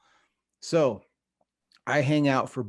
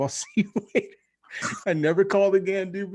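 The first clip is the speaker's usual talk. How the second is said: higher, laughing through the words; cringing laugh; 7 semitones higher, faster than usual. Real, not acted. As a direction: giggle with embarrassment. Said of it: "I tell that story and I cringe".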